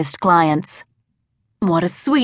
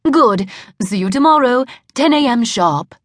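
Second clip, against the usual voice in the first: put-on phony British accent; 4 semitones higher than usual. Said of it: "the phony Brit".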